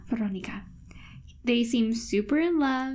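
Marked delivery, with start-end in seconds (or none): 0.1-0.6 softly echoed Spanish-style Veronica; Spanish accent. Retold or imitated. Podcaster echoing her co-host, soft Spanish accent. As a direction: say Veronica quietly with a Spanish lilt. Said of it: "with a Spanish accent".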